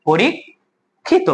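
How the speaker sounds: পরীক্ষিত very slowly, as "po-rik... khi-to"; syllable-by-syllable pronunciation. Shown as a demonstration. Teacher repeats for students, পরীক্ষিত in drawn-out pieces. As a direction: leave a long gap in পরীক্ষিত after "porik". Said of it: "পরীক্ষিত উচ্চারণটা কি হবে?".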